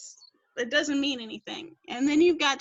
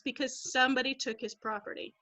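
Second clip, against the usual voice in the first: ordinary talk, nothing marked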